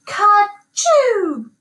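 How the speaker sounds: high gobbling "Ka-choo"; gobbled sneeze. A narrator's reading. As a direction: turkeys sneeze high and loud. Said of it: "the turkeys gobbled out".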